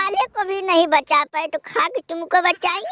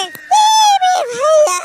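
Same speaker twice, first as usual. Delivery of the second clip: very high, loud, slow wail; mock anguished shout; 10 semitones higher, 6 dB louder, much slower than usual. Loser howls on hearing his turn is next, hammed-up. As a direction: howl it out in comic despair.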